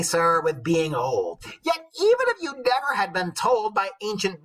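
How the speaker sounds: high, loud, slow mock old woman; old wife reading. The old wife scolds her knight, high and loud. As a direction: read it as a lecturing old crone, drawn out. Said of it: "she's flipped it on him".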